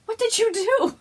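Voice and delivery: high, loud, laughing "what did you do"; excited disbelief. Retold or imitated. Thanking a friend for too many gifts, high laughing squeal. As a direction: squeal it at a friend who overdid it. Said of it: "I'm like".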